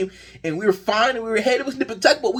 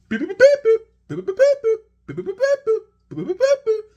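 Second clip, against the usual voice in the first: high, quick mock beeping of a buzzing phone; imitated phone beeps; 13 semitones higher, 4 dB louder, much faster than usual. Fan imitating his phone blowing up overnight, high mock beeps. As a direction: chirp the beeps like a phone alarm going off.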